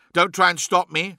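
defiant warning. A narrator's reading. Redwayne to the man holding him back, defiant. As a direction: stand your ground forcefully.

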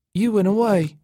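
high, thin statement falling at the end. A narrator's reading. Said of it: "she said in a thin voice".

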